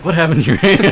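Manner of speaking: high, loud imitated horrified question; disgust slash worry. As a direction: mimic a kid both grossed out and worried. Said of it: "almost like a disgust slash worry".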